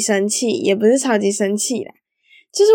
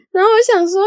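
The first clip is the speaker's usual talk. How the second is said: laughing; 9 semitones higher, faster than usual. Real, not acted.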